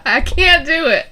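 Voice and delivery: high, loud squeal of refusal; embarrassed protest. Real, not acted. Refusing the friends' dare, high and loud. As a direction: squeal that you just can't. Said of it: "I get way too embarrassed".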